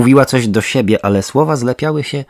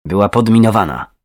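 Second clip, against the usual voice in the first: plain reading voice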